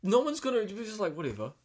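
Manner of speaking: high, loud, laughing exasperation; laughing disbelief. Real, not acted. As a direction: laugh at how silly it is. Mocking friend's silly idea.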